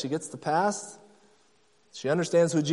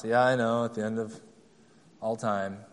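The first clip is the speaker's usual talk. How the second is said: lower, much softer rote recital; automatic response; 7 semitones lower, 6 dB quieter than usual. Retold or imitated. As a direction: toss it off like a memorized Sunday school answer. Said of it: "She had an automatic response".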